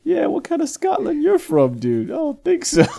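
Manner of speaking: high, loud, laughing disbelief; laughing mock challenge. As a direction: tease the accent through laughter. Mocking the cartoon accent.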